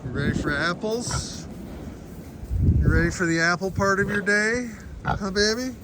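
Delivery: high, sing-song coo. Real, not acted. Owner cooing to her pigs before apples. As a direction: welcome the pigs like babies.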